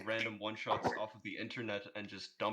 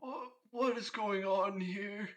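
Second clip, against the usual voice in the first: higher, slow, baffled Santa voice; bewildered Santa; 10 semitones higher, slower than usual. Acted. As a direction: stretch out Santa's confusion.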